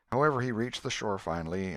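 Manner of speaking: plain reading voice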